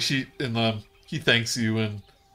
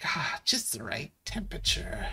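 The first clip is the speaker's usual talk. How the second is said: breathy higher whisper of contentment; whispered character voice; 5 semitones higher, 8 dB quieter than usual. Acted. DM voicing a bather sinking into warm water, whispered. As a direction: sigh it out in a hushed whisper.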